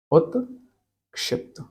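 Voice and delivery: उत्क्षिप्त spelled out slowly and softly, syllable by syllable; slow careful pronunciation. Shown as a demonstration. Teacher correcting herself, slowly pronouncing उत्क्षिप्त for students. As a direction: say उत्क्षिप्त very slowly and gently so each sound is clear. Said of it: "उत्क्षिप्त, sorry".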